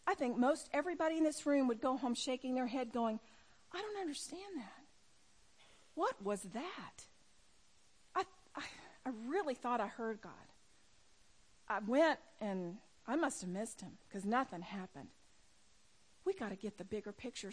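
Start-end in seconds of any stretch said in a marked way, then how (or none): 3.7-15.1 halting, mildly deflated confusion; acted bewilderment. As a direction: act out quiet, hesitant doubt. Acting out a baffled failed missionary.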